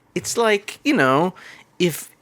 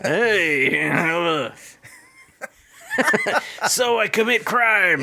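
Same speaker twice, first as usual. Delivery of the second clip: put-on Southern accent; 6 semitones higher than usual. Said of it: "I'm doing too much Southern accent".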